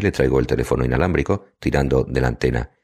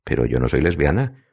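usual speaking voice

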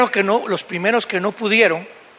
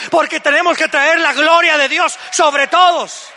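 higher, louder fervent praying; disciples' fervent prayer; 6 semitones higher, 4 dB louder than usual. Acted. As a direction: pray with full fervor for the boy. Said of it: "debieron haber llegado a él y decir". (oración ferviente de los discípulos, más aguda y fuerte)

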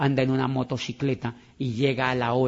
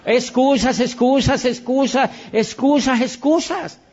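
mocking excuses; 10 semitones higher, 5 dB louder, slower than usual. Mocking list of excuses, climbing.

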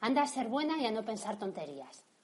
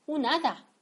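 surprised; 8 semitones higher, 7 dB louder than usual. Acted. Mother surprised by child's belief.

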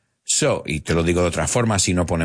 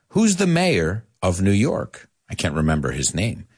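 ordinary talk

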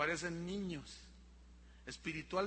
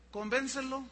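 ordinary talk

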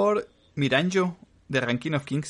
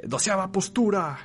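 dramatic anime shout; 7 semitones higher than usual. Retold or imitated. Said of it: "ya dice así como que".

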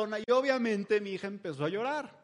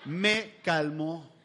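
"Me calmó" drawn out slowly, every syllable lingered on; slow, savoring repeat; 2 semitones lower, 3 dB louder, much slower than usual. Shown as a demonstration. Telling the audience the shirt worked, in a slow drawn-out repeat. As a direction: let "Me calmó" sink in, slow and deliberate. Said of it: "¿y qué creen?".

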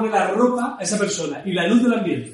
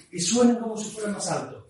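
softer, airy projected whisper; stage whisper; 6 dB quieter than usual. Shown as a demonstration. Coach demonstrating a carrying whisper. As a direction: whisper so it still carries. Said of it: "hacer los susurros".

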